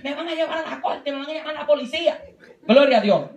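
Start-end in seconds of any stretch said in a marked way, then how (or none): 0.0-2.2 lightly voiced mother, a bit higher, laughing through it; mock-scold like mom waking her child; a hint of a scolding mom, amused. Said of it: "mami me levantaba y me decía".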